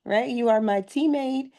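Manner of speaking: ordinary delivery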